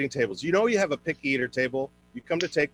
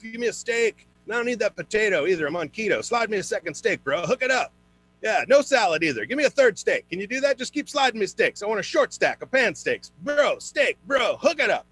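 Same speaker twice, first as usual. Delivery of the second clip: higher, pushy dude-bro voice; 8 semitones higher than usual. Retold or imitated. Bro customer begging waiter for steaks, dude-bro voice. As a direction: wheedle like a gym bro. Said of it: "guys always want the bro deal".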